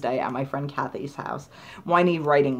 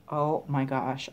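normal talking voice

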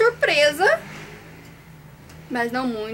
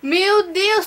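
shocked gasp; 5 semitones higher, 5 dB louder than usual. Real, not acted. Grade finally appears.

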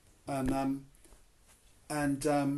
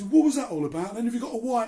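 louder, confronting the stranger he suspects; suspicious demand; 8 semitones higher, 9 dB louder, faster than usual. Retold or imitated. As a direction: demand it loudly, half-accusing. Suspicious pub guy confronting.

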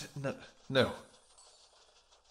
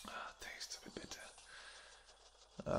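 hushed whisper; 11 dB quieter, much slower than usual. Acted. Tasting blood, hushed aside.

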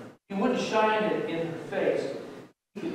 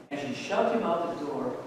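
ordinary delivery, nothing marked

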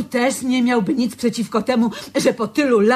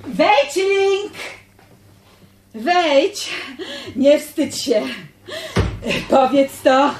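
calling in; 4 semitones higher, slower than usual. Acted. Calling her admirer inside.

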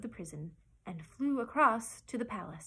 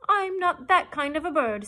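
higher, loud parrot voice; parrot's retort; 7 semitones higher, 12 dB louder than usual. A narrator's reading. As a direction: protest it loudly as an indignant bird. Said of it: "said the parrot".

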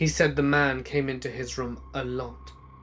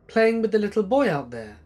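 lightly voiced child, pitched higher; a hint of a little boy's higher voice; small child; 7 semitones higher, 3 dB louder than usual. A narrator's reading. Nephew answering what he was doing, lightly childlike.

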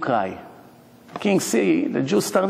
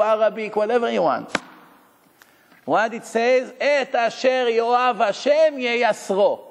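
high, impassioned sermon voice, slowing on the verse; fervent preaching; 7 semitones higher than usual. Acted. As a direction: lift the voice and preach it with fire. Preacher rising to full force.

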